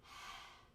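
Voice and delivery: a long breathy h like fogging a window; breathy h demo. Shown as a demonstration. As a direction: breathe out on the glass. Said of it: "so you go".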